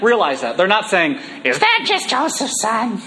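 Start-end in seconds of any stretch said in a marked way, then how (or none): 1.4-3.0 put-on cranky old woman. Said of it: "cranky old woman".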